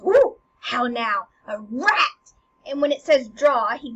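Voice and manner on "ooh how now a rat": loud, slow, stretched "ooh"; acted alert exclamation; startled discovery. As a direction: pounce on the hidden intruder. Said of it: "Hamlet hears him and he goes".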